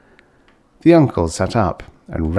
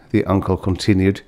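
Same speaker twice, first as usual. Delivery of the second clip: ordinary delivery, nothing marked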